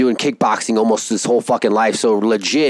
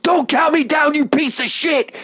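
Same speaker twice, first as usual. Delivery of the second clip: much higher mock wrestler shout; imitating angry promo; 14 semitones higher than usual. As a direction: yell it at the referee in character. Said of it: "He's like".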